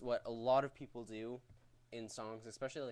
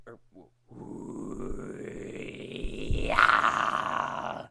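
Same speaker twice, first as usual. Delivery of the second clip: false chord scream; 2 semitones lower, 11 dB louder, much slower than usual. Shown as a demonstration. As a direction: rasp out a loud scream. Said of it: "So, like".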